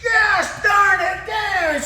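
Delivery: high, slow mock shout of cursing; hammed-up angry shout; imitated cursing. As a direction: bellow it like a furious sleeper. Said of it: "using profanity".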